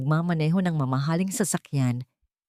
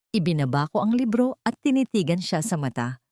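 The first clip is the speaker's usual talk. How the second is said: ordinary delivery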